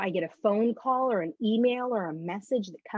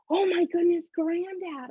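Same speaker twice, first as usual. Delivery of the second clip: put-on high, excited child gushing; bubbly little kid; 9 semitones higher than usual. Bubbly kid exclaiming to granddad in the rose garden, excited. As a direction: gush like a delighted five-year-old. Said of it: "I was very bubbly little kid".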